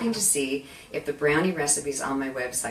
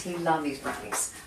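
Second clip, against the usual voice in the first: dropped to a softer aside; soft repeat; 6 dB quieter than usual. Real, not acted. Host repeating her dad's favourite, softly. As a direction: let it settle quietly.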